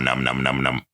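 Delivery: soft puppet munching noises; munching sounds. Shown as a demonstration. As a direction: num num chewing, quietly. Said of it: "do some sounds for that".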